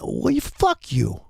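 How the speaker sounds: high, drawn-out angry curse; pissed off. Real, not acted. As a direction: lose your temper, voice shooting up slowly. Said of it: "I'm getting pissed off".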